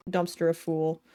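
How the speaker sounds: ordinary talk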